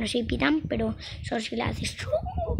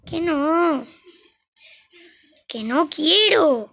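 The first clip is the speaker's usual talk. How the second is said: whiny protest; 4 semitones higher, 8 dB louder, slower than usual. Real, not acted. Kid protesting against leaving.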